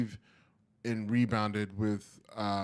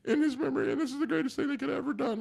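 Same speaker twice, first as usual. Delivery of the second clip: much higher voice, mock tearful mother; grieving mom. Imitating the grieving mom at the ballpark, high and mock tearful. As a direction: play the brave grieving mother, voice breaking. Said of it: "then the mom's just like".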